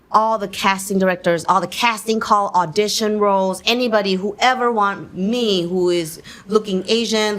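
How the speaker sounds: ordinary delivery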